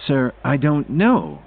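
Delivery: understated apology to a superior; apologetic. A narrator's reading. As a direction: drag it out apologetically. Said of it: "in an apologetic tone".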